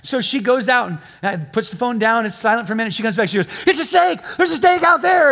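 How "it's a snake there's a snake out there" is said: high, loud imitation of a terrified wife; mock-panicked cry of alarm. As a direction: raise the alarm as a hammed-up frightened wife. Wife screaming about snake, retold.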